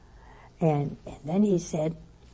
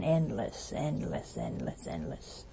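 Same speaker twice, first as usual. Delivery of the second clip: hushed repetitions sinking lower and softer; fading away; 10 semitones lower, 10 dB quieter, slower than usual. Shown as a demonstration. Preacher picturing a horizon without end, fading away. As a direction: let each repeat fade further into a murmur. Endless horizon trailing into distance.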